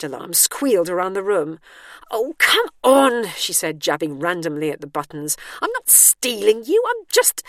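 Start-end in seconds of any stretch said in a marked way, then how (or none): 2.1-3.3 high, loud, drawn-out frustration; exasperated. A narrator's reading. Frustrated at the blaring alarm. 5.6-7.4 high, loud, rising objection; exasperated protest. A narrator's reading. Protesting over the alarm.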